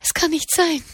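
high-pitched shocked disbelief; entsetzte Abwehr. A narrator's reading. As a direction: refuse to believe it, voice rising high. Tochter glaubt die Todesnachricht nicht. (hohe, entsetzte Ungläubigkeit)

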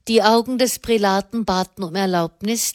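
ordinary delivery, nothing marked

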